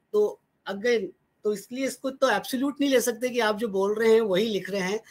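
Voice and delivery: ordinary delivery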